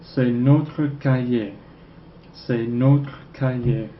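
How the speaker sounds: slow, careful French: C'est notre cahier; slow French model. Shown as a demonstration. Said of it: "This is our notebook".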